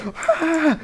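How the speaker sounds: very high thin mock scream; small acted shriek of pain. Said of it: "bunny is screaming in pain".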